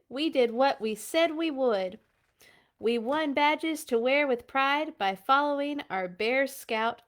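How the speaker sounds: lively storybook read-aloud; reading to children. Acted. Parent reading a Bear Scouts book to kids, lively. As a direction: bring the rhymes to life for kids.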